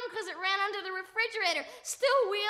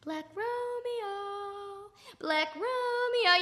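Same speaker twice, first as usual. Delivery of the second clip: drawn-out, singsong calling of a name; coaxing call; 2 semitones higher, much slower than usual. Acted. Baiting the cat by calling out his name, drawn-out coaxing call. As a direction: call it slowly to lure an animal. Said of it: "calling out to him his name".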